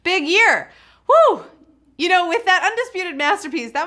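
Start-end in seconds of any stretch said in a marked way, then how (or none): 1.1-1.4 short high, loud woo; quick excited whoop; cheer. Real, not acted. Cheers after a big year.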